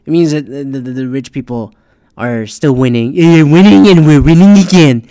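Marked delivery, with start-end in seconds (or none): 3.1-5.0 loud, put-on gloating voice; hammed-up victory cheer; mocking boast. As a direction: crow it like a cartoon winner. Mimics the rich boasting of winning.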